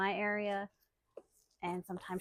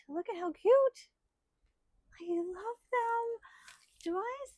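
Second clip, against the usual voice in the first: much higher, gushing over something cute; delighted squeal; 11 semitones higher than usual. Real, not acted. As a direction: coo over it with glee. Delighted by gift.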